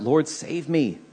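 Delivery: crying out for help. A narrator's reading. Peter slipping beneath the waves, crying out to Jesus. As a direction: cry "Lord" out, urgent but held back. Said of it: "And he cries out".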